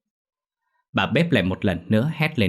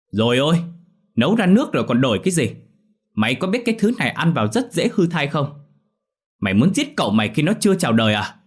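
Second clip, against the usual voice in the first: high, loud, fast scolding; shrill nagging; 6 semitones higher, 4 dB louder, faster than usual. Acted. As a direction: berate the cook in a shrill rush. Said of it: "Lý Oanh lại choe choe cái miệng".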